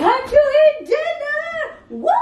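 very high coaxing voice; pet talk. Real, not acted. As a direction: coax the cat sweetly. Coaxing the cat.

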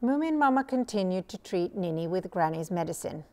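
ordinary delivery, nothing marked

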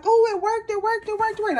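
high, loud imitated cheering; retold elation. She re-enacts her joy for viewers, high and loud. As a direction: replay the moment it finally worked, squealing. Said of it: "And I'm like".

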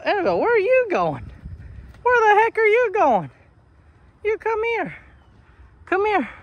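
high-pitched, indignant calling; exasperated calling. Real, not acted. Owner calling a wandering animal back, exasperated. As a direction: call out in high, baffled protest.

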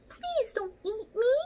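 high, slow tiny mouse squeak; squeaky mouse. Acted. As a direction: squeak like a scared baby mouse. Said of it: "squeaks the little mouse".

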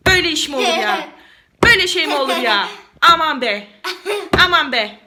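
quick, punchy fed-up exclamations; mock exasperation. Mother exclaims to child over laundry, mock exasperation. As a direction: exclaim briskly in playful exasperation.

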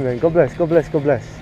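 ordinary talk

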